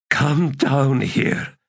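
rasped order, high and slow. A narrator's reading. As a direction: draw the command out in a rasp. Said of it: "she said in a rasp".

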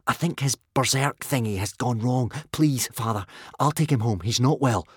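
ordinary delivery, nothing marked

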